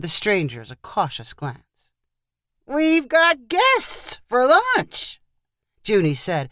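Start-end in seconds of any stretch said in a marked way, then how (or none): 2.6-5.2 high, drawn-out cheerful announcement; delivering good news. A narrator's reading. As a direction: announce it slowly as if it's great news. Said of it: "as if he was delivering good news".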